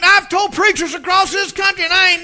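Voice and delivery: high, loud aggrieved protest; wounded pride pouring out; indignant complaint. Retold or imitated. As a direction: congregant protesting to the preacher.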